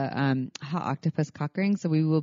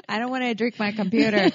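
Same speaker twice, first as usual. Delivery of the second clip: laughing; 6 semitones higher, 4 dB louder than usual. Real, not acted. Joking, laughing.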